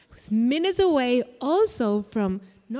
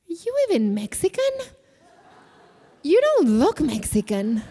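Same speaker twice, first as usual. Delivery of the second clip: Paula Deen questioning; 4 semitones higher than usual. Retold or imitated. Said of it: "and she says".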